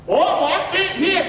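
high, drawn-out complaint; mock whining player. Preacher mimicking a player making excuses to coach, whiny. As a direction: whine it slowly, high in the voice.

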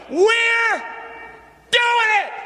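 very high, loud climactic cry; preacher's final rallying cry; biggest shout yet. Real, not acted. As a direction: give it everything. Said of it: "Come on, the biggest one yet".

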